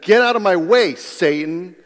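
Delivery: loud, high-pitched command; shocking rebuke. Acted. Turning on someone trying to help, sudden loud rebuke. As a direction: burst out forcefully, ordering them aside. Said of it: "he was trying to shock Peter".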